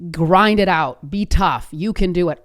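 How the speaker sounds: mock-tough pep talk, hit hard; tough self pep talk. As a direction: push each phrase like a coach firing someone up. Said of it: "a story that we could tell ourselves".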